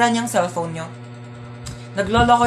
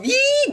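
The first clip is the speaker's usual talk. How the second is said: high excited squeal; kilig squeal. Acted. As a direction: squeal like a giddy fan. Kilig squeal over crush. (matinis na tili sa kilig)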